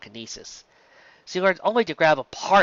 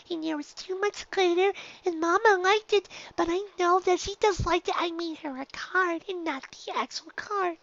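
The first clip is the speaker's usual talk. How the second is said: put-on young filly voice; 5 dB quieter than usual. A narrator's reading. Dinky answering her teacher in a high filly voice. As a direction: play a small shy filly.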